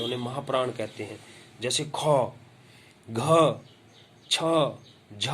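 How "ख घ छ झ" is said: ख, घ, छ, झ each with a strong puff; aspirated consonants. Shown as a demonstration. Teacher demonstrating mahapran, ख, घ, छ, झ said with more air. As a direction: push extra breath through ख, घ, छ, झ, one by one. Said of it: "अधिक वायु लगती है".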